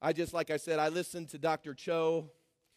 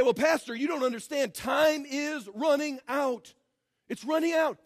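higher, shouted mock alarm, slowed on "Time is running out"; mock panic; 5 semitones higher than usual. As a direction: play the fretful member. Mocking an anxious churchgoer.

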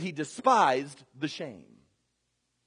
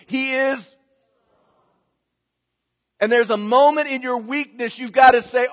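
loud, driving sermon voice; preaching hard; 5 semitones higher, 9 dB louder than usual. Acted. Pastor urging his congregation, full-force preaching. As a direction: hammer each point home to the room.

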